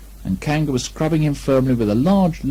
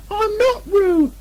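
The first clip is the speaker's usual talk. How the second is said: very high, slow squeaky protest; insist, stressing not; loudly; much slower than usual. Acted. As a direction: Piglet protesting. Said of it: "said Piglet loudly".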